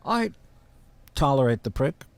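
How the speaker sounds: tolerate the prick said through a laugh. Real, not acted. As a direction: laugh it off while insulting him. Asked about his impersonator.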